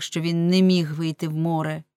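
ordinary delivery

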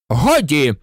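short, firm bark; barked order. A narrator's reading. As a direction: snap it to silence the pupil. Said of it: "гаркнув Снейп".

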